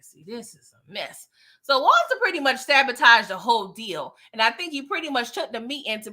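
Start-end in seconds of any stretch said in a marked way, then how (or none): 0.0-1.3 soft, low aside; a soft, low aside at the mess on screen; dismayed murmur. Real, not acted. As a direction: drop low and mutter it.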